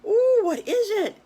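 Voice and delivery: high and loud, squealing with curiosity; a little pig bursting to know; eager piglet squeal; excited piglet. Acted. Piglet begging for the surprise.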